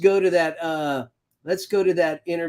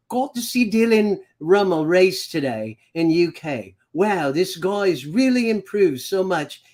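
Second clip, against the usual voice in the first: lightly put-on English accent, slightly raised pitch. Host reads Steve's post aloud, in a mock English accent. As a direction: imitate an English rider's accent while reading his post. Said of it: "That was my English accent".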